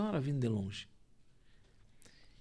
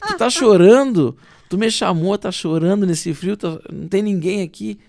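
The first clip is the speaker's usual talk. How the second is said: loud, higher, incredulous retold protest; bewildered protest; 5 semitones higher, 9 dB louder than usual. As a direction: press her for an explanation, loudly. Retells his bewildered protest to woman. (protesto perplexo, em voz alta)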